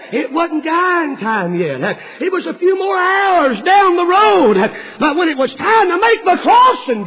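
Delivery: high, loud, drawn-out preaching cry; preaching shout. Acted. Preacher crying out to the congregation, high and loud. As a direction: ring it out across the church.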